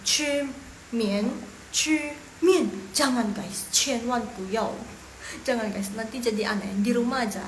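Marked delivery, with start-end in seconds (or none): 0.1-2.7 "Chu mian" split syllable by syllable; word-by-word beginner reading of "chu mian"; how not to read. Shown as a demonstration. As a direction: demonstrate stilted "chu mian" with a gap before each "mian". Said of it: "jangan bacanya pinyin itu kayak gini".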